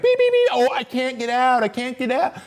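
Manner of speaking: high squeaky beeps, then a whiny high voice; mock the stuck driver in a silly high voice; hammed-up impression of a trapped trucker. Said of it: "This guy's like".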